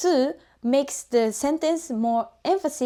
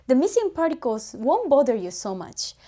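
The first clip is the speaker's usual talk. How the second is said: ordinary delivery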